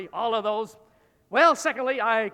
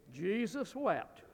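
quiet; 10 dB quieter, slower than usual. Shown as a demonstration. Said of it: "I wonder if you can guess".

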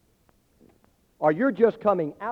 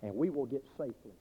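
gentle closing appeal; 5 semitones lower, 6 dB quieter than usual. Real, not acted. Preacher gently applies the story to his listeners, soft and low. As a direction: lower and softer, fading to a hush.

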